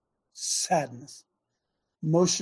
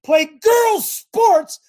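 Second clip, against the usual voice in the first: loud shout; 14 semitones higher, 10 dB louder, much slower than usual. Real, not acted. Ranting about women's sports.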